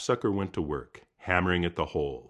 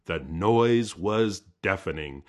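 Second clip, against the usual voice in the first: ordinary delivery, nothing marked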